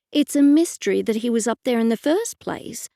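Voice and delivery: usual speaking voice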